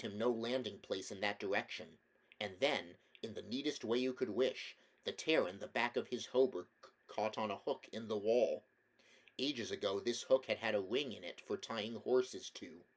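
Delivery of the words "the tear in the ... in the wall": plain reading voice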